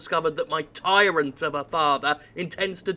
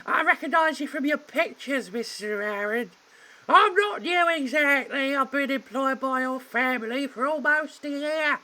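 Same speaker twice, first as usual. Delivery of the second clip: maid voice; 10 semitones higher than usual. Acted. Maid calming the butler, falsetto. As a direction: chirp it out sweetly. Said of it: "I will for her".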